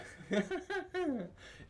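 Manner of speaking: high-pitched mocking chuckle; mocking laughter. Game master voices a figure's laughter in your head, mocking. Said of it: "you hear just mocking laughter".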